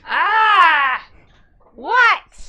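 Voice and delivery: sudden high startled yelp; startled cry. Acted. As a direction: jump in shock, then demand. Startled cry at being grabbed.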